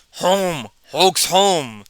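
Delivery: slow, simple brute voice saying Hulk's home. Acted. Hulk recognizes Earth on screen.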